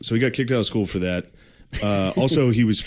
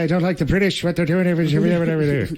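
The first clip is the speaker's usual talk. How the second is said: mocking, broad Irish accent, pitched up; a thick Irish brogue, ranting about the British; 9 semitones higher than usual. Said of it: "knock that voice out of him".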